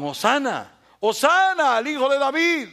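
loud, high crowd acclaim; crowd shouting praise. Retold or imitated. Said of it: "Los que gritaban". (la multitud gritando Hosana con júbilo)

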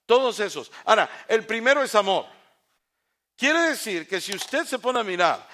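fervent sermon voice; preaching at full force. Acted. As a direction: drive the point home from the pulpit. Preaching on the fruits.

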